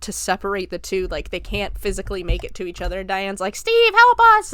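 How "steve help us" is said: high, loud retold cry for rescue; desperate call for help. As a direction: act out a mother yelling to her husband. Voicing Diane crying for help.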